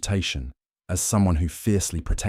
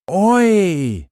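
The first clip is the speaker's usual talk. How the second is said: a sharp, much higher, much louder shout across the street; loud call; 14 semitones higher, 11 dB louder than usual. A narrator's reading. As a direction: bellow it to stop the pack. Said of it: "he called out".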